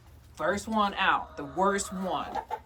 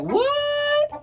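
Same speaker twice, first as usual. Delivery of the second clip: squeaky high "What?" as the hen; high, loud mock hen "What?"; 8 dB louder than usual. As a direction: voice the hen's indignant squawk. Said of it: "She's like".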